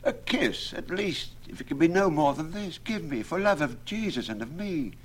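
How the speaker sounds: very high, begging suitor's voice; high pleading lover. A narrator's reading. Lovesick Absalom begs Alison for a kiss, very high. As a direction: beg way up high for one kiss. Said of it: "Absalom said".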